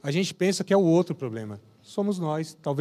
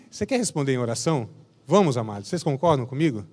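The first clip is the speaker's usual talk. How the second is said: ordinary talk, nothing marked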